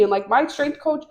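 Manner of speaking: ordinary delivery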